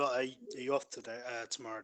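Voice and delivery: usual speaking voice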